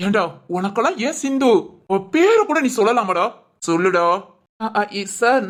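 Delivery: ordinary delivery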